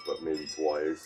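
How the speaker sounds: ordinary delivery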